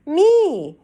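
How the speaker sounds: put-on high, loud toddler yelp; excited child shout. Fudge shouting to get another turn on the bike. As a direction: yelp it like a keen little boy.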